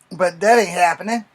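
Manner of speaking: ordinary delivery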